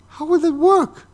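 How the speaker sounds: high, loud incredulous question; mock bafflement. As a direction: squeal it like a baffled fan. Voices baffled comic book fans.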